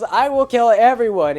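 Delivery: loud, high shout; Iggy screaming at the pilots; yelled. Retold or imitated. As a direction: shout it, done arguing. Iggy threatens the whole plane.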